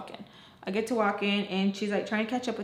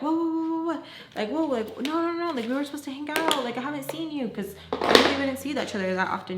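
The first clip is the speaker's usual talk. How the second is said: high, hurried imitation of a protesting friend; mock alarmed pleading, pitched up; retold pleading protest; 6 semitones higher than usual. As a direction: act out stopping a friend from leaving. Acts out friend begging her stay.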